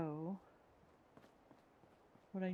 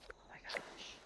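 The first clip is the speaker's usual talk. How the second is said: whispering; 7 dB quieter than usual. Real, not acted. Aside about Cryptid's channel.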